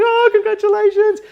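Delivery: very high, loud congratulating squeal; excited stranger. Retold or imitated. Passer-by congratulating expectant parents, high excited squeal. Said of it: "and she's like".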